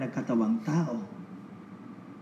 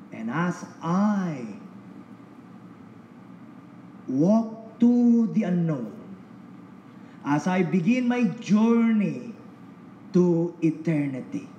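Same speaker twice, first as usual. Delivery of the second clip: fervent testimony; 4 semitones higher, 3 dB louder, slower than usual. Real, not acted. Solemn rising testimony.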